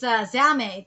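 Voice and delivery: ordinary talk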